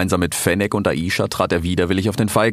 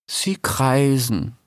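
disappointed; 5 semitones higher, much slower than usual. Acted. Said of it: "sagte Kenneth enttäuscht".

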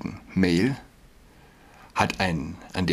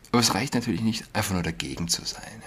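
ordinary talk, nothing marked

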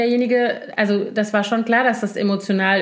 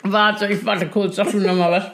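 ice in mouth. Real, not acted. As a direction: mouth full of ice, words blurred. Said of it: "Die hat einen Eiswürfel im Mund".

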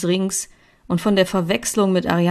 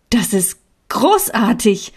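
rising higher and louder in delight; joyful exclamation; 6 semitones higher, 5 dB louder than usual. A narrator's reading. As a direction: call it out, overjoyed. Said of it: "rief ich".

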